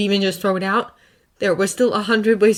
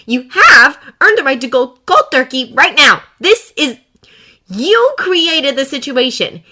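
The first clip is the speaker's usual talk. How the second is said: higher, louder outraged rebuke; angry scolding; 8 semitones higher, 8 dB louder than usual. Acted. Potts berating Tony for drinking, loud and angry. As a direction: tear into him for dodging responsibility.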